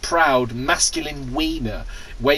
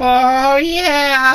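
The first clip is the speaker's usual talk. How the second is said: high moaning voice; 11 semitones higher, 6 dB louder than usual. Acted. Voicing Luke moaning.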